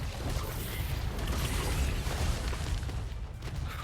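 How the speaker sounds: breathy laugh. Real, not acted. Laughs after mock grief.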